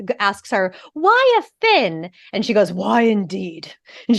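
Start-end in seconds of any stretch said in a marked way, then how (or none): 0.9-2.1 put-on childlike question. Child asks in retold scene. 2.7-3.7 put-on dry old lady reply. Ariadne's dry reply, retold.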